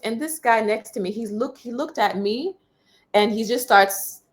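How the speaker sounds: normal talking voice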